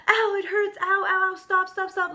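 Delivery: high-pitched retold cries of pain; mock screaming. Customer having a nail ripped off, mock screaming in pain. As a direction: yelp it high, without a full scream. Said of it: "I'm screaming at this nail salon".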